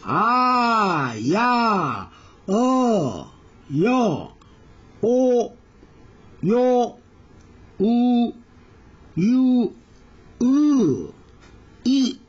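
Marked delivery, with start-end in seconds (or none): 0.0-11.1 slow single Korean vowels, one per beat; Korean vowels drilled. Shown as a demonstration. Teacher modelling Korean vowels for Japanese students, deliberate. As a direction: model each Korean vowel clearly with pauses. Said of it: "韓国語というのは基本母音、この十個".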